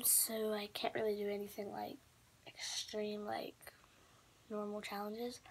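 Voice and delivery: soft nighttime whisper. Real, not acted. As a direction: keep it hushed, people sleeping. Nighttime, can't be loud.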